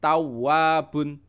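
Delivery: teacher demonstrating shaddah; pressed ww. Shown as a demonstration. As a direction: press the ww in Tawwabun but not long. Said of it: "Wanya juga ditekan".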